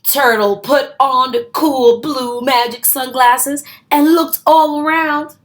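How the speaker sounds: usual speaking voice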